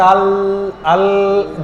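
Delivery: আল- stopped on a lingering L; held L sound. Shown as a demonstration. Preacher isolates the tongue's touch, held L in আল-. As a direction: leave the tongue resting on the L of আল-. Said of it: "জিহ্বাটা এখানে হালকা একটু লাগতেছে".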